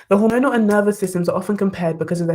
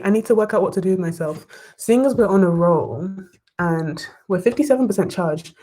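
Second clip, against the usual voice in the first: ordinary talk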